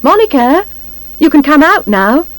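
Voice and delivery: high, lilting call; high call to someone indoors. A narrator's reading. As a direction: call her out of the house. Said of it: "He turns and calls".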